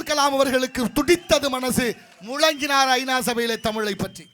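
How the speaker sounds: loud platform speech swelling to a thundering climax; oratory. Acted. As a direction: declaim with rising force to the crowd. Stage oration, swelling to climax. (முழங்கும் மேடைப் பேச்சு)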